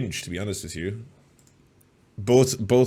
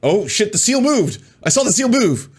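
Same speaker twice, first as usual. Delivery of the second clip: much higher, loud excited outburst; excited shock; 12 semitones higher, 6 dB louder than usual. Real, not acted. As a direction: gasp it out like breaking news. Shocked reaction to seal moving.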